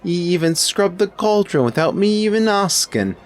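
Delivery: high, loud cook with a wobbly put-on accent; cook's drifting accent. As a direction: let the servant's accent wander as it goes. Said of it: "what accent that was turning into at the end there".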